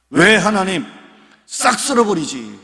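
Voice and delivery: high, loud protesting cry at God; defiant questioning. Retold or imitated. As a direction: demand an answer from God, indignant. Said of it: "꼭 반항하는 것 같죠". (하나님께 따지듯 높고 큰 소리로)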